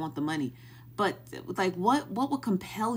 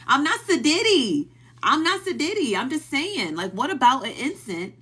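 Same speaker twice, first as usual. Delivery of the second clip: higher, louder, heated denial; heated protest; 6 semitones higher, 5 dB louder than usual. Real, not acted. Telling chat she's not bougie, heated loud protest. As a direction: push back hard on the chat. Defending herself to chat, raised voice.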